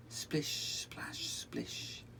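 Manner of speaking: soft whispered splashing; whispered splashes. Acted. Narrator voicing Ruff's shower, whispered. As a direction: whisper the water sounds. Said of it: "Ruff ... having a shower".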